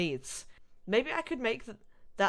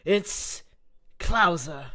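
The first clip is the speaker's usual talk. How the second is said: louder name drop, a lightly voiced heavy; Krauser voice; 8 dB louder, much slower than usual. Krauser telling Ashley his name, heavy and curt. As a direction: say the name like a threat. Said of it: "the Krauser voice".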